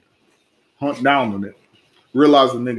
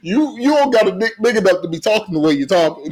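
tough-guy trash talk; 6 semitones higher, faster than usual. Retold or imitated. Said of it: "you big dick talk".

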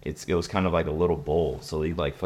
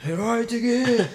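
high-pitched frantic cue; squeaky, hammed-up urgency; frantic urging. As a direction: imitate an excited friend urging him on. Acting friends urging the hit.

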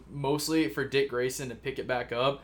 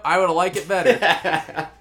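laughing; 4 semitones higher, 8 dB louder, slower than usual. Real, not acted. Laughing at own pitch.

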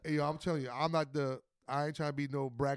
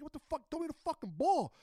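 retold frustration; 11 semitones higher than usual. Player demanding the ball from teammates, retold frustration. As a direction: replay an exasperated plea at higher pitch. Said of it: "And I was pissed".

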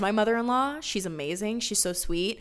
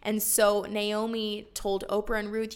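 ordinary delivery

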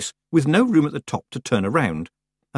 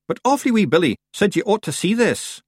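put-on Scottish accent; 10 semitones higher than usual. Rob Anybody voice.

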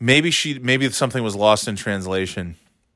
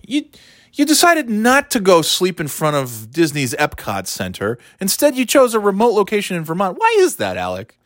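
higher, prissy reporter voice; mock reporter; 8 semitones higher than usual. Comic mocking a reporter's question to Alec, prissy. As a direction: ask it with fake earnest concern.

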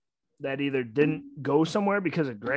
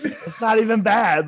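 higher, louder, emphatic repeat; louder disbelief; 7 semitones higher, 7 dB louder than usual. Real, not acted. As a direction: insist it's not even bad.

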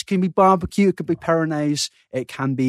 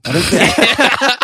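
laughing; 10 semitones higher, 5 dB louder, much slower than usual. Real, not acted. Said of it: "laughing dismissal".

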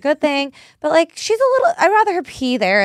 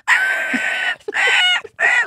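high-pitched imitated yelps; dog yelping. Imitating her dog heard over the phone, shrieky pained yelping. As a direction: yelp like a dog whimpering in pain.

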